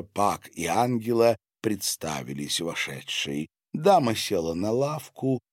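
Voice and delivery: plain reading voice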